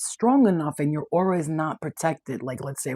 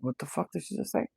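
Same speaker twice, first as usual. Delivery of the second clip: inner voice; 5 dB quieter than usual. Retold or imitated. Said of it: "in the back of their head, they're like".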